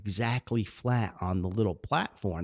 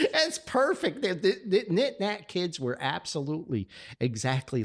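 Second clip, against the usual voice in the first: laughing delight; 7 semitones higher than usual. Real, not acted. Cracking up at the description.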